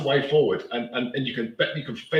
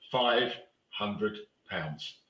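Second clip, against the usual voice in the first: slow emphatic figure; 3 semitones lower, 5 dB quieter, much slower than usual. Real, not acted. Stressing the ombudsman fee.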